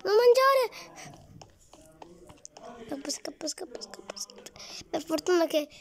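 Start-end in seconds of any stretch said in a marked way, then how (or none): 0.0-0.6 much higher, louder frantic laughing screams; panicked shriek. Real, not acted. Monster catches him in game. 2.9-4.5 fading, breathless whispered run; urgent whisper. Real, not acted. Fleeing the monster.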